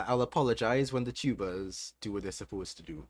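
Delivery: usual speaking voice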